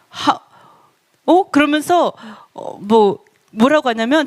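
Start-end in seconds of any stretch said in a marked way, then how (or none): none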